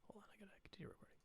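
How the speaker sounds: quick hushed whisper off mic; whispered aside. Real, not acted. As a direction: whisper it fast, like a side note. Aside while fixing the recording.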